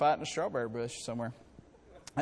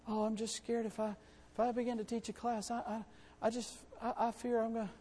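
much softer, stammering mock fear; mock timid believer; 5 semitones higher, 7 dB quieter than usual. Preacher mimicking a fearful would-be teacher, soft and stammering. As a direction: play the scared person who won't teach.

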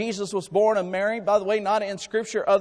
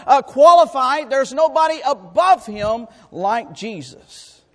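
loud proclaiming preacher; preaching; 5 semitones higher, 9 dB louder than usual. Acted. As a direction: proclaim it to the whole church. Preacher proclaims Jesus highest.

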